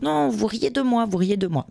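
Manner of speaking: imitating a sulky child insisting she is mocked; petite fille vexée. Et elle dit toujours. (imitation d'une petite fille vexée, plus aiguë et lente)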